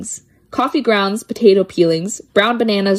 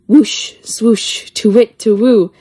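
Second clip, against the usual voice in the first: spooky whooshing; 2 semitones higher, 4 dB louder, slower than usual. Acted. Wind and owl sounds in poem.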